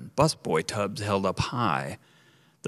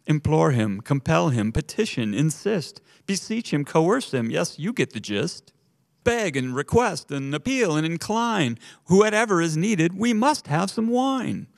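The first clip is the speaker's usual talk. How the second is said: rising, higher and louder frantic begging; panicked pleading; 7 semitones higher, 4 dB louder than usual. A narrator's reading. Waiters on their knees begging the mother, panicked and rising. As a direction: beg her, more desperate each line. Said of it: "voices all chorused in panic and grief".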